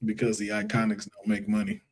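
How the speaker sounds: low, quiet deadpan sarcasm; understated sarcasm, pitched well down; sarcastic. Real, not acted. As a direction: slip it in softly as a dry dig. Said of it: "that was sarcasm".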